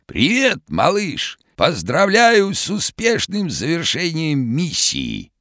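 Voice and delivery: hoarse, very high cartoon shout; vintage cartoon voice. A narrator's reading. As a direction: call out like an old cartoon character. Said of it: "хриплым, как в старом мультике, голосом прокричал".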